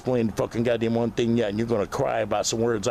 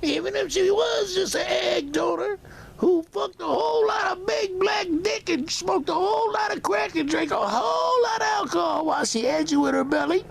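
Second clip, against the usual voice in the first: much higher shouted mimicry of the mother; mock mother voice. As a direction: ham up a shrill, shouting impression of her. Mocking the mother's own voice.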